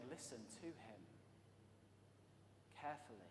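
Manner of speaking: a soft, serious charge; quiet earnest command. Retold or imitated. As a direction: urge them gently but firmly.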